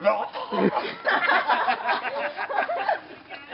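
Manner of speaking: long falling laugh; laughter. Real, not acted. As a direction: crack up laughing. Laughing at own story.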